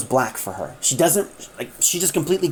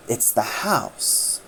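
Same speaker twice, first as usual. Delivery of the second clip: hushed realization; 5 semitones lower, 7 dB quieter, much slower than usual. Acted. Doctor's dawning realization.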